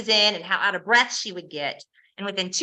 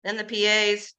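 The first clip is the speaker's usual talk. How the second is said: usual speaking voice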